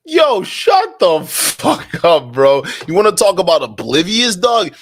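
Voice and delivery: loud, slow, disbelieving yell at the clip; outraged shout. Real, not acted. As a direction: shout him down, drawn out.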